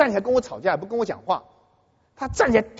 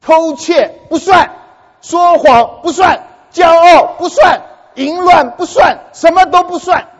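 slow, much louder and higher, word by word; preaching shout; 8 semitones higher, 9 dB louder, much slower than usual. Acted. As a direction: hammer each excuse out like a pulpit blast. Preacher mocking sinners' excuses.

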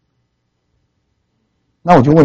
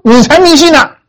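indignant retort; 12 semitones higher than usual. Retold or imitated. Atheist retorts angrily.

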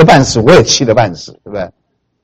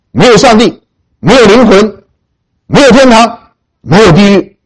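dead certain declaration; 6 semitones higher than usual. Retold or imitated. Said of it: "你看他讲的肯定不肯定啊".

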